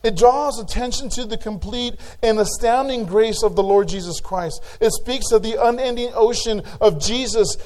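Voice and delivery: a pastor thundering that grace is enough, full-force preaching; climactic preaching. Acted. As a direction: build the sermon to its peak.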